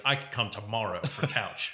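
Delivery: dim, blunt caveman talk; broken words like a caveman. Retold or imitated. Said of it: "makes T-Train sound like a caveman".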